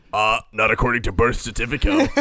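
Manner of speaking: put-on character voice; guy with the voice. Host imitating that guy about a certificate, put-on voice. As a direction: do an impression of a character quibbling. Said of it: "that guy with the voice".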